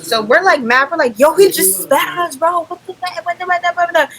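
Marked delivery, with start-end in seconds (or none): 1.2-4.1 high-pitched mock yell at the guy; retold yelling. Friends yelling at the boy who spat on them, high mock yell.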